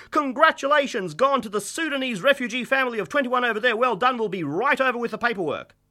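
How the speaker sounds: fast auctioneer patter with barked huts. Acted.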